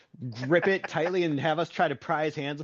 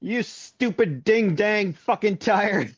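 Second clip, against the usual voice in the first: slow, higher, louder hammed-up curse; mock angry grandpa; 5 semitones higher, 3 dB louder, much slower than usual. Grandpa splashed with mud, slow hammed-up angry curse. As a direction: drag out every word of the curse. Grandpa muddied by truck tire.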